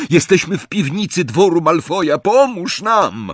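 high, loud, urgent plea; desperate roar. A narrator's reading. Harry tells the eye where they are, desperate roar. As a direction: plead at the top of your voice. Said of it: "ryknął w szalonej rozpaczy".

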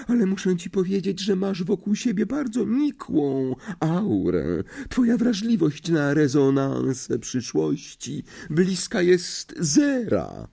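high, slow, pitying seer voice; condescending seer. A narrator's reading. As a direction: pity her lack of aura. Trelawney to Hermione.

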